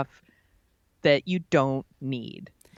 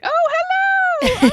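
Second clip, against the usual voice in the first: very high, loud, drawn-out British greeting; over-the-top falsetto attempt at British; bad British accent; 8 dB louder, much slower than usual. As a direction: hoot it in a badly faked British accent. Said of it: "I wish I could do a British accent".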